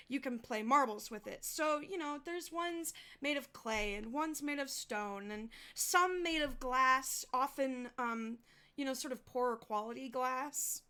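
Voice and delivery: usual speaking voice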